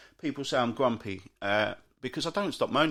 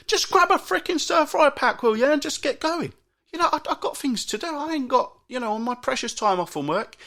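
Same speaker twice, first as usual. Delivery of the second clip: higher, louder, fed-up outburst; exasperated rant; 9 semitones higher, 4 dB louder than usual. Retold or imitated. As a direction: snap at them to hurry up. Ranting at slow shoppers.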